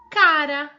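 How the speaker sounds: "cara" bem alto, com o R marcado; faça soar o R de "cara"; cara com R forte. Shown as a demonstration. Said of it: "Vamos achar uma palavra com R forte?". ("cara" dito alto, destacando o R)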